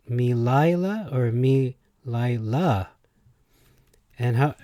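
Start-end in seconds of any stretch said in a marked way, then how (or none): 0.1-2.9 "me Lila" as mee-LIE-la, then mee-lie-LAH; shifting syllable stress. Shown as a demonstration. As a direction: slowly move stress in "me Lila" from middle to last. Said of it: "put a different emphasis on words".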